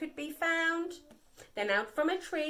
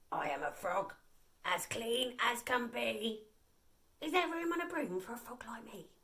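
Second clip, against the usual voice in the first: lower, softer frog voice fading to a whisper; croaking frog; 3 semitones lower, 7 dB quieter than usual. A narrator's reading. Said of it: "said with a croak".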